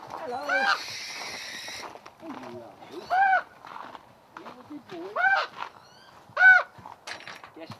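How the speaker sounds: high, soft cooing to the birds; pet talk. Real, not acted. Falconer greeting the raven and birds, high cooing pet talk. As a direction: coo at them like pets.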